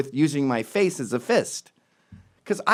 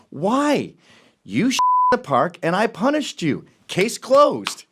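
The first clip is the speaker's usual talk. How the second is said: higher, louder triumphant verdict; smug retort; 7 semitones higher, 4 dB louder, slower than usual. Retold or imitated. Man gloating over dog owner, smug verdict. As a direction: deliver it like a judge banging a gavel. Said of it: "I should have said".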